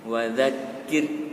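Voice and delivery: slow "Wadzakir" with smiling, spread lips; thin final R. Shown as a demonstration. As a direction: draw out "Wadzakir", ending on a light thin R. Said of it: "seperti senyum".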